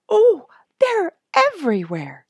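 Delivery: put-on a child suddenly seeing herons in every tree; excited child. A narrator's reading. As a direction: burst out in wonder as the birds appear.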